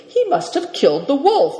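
high, excited delight; joyful. A narrator's reading. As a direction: exclaim it with happy excitement. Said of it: "cried Seven Stars joyfully".

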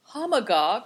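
an eager cry of joy; cried out. A narrator's reading. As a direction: call it out gladly. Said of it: "cried Seven Stars".

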